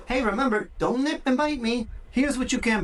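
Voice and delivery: upbeat high voice for a puppy; high puppy-talk correction. Shown as a demonstration. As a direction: chirp it to the pup, friendly but firm. Owner correcting a nipping puppy.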